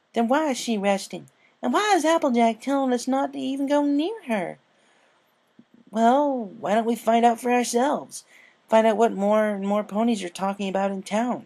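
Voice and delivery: put-on an eager child pony plotting; young filly. A narrator's reading. Scootaloo urges friends to investigate, high filly voice.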